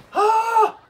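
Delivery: high loud shocked gasp; sharp gasp. Real, not acted. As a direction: gasp in delight. Big gasp at the ending.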